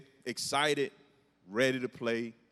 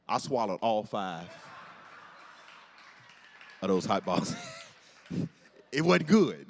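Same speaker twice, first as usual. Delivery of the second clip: normal talking voice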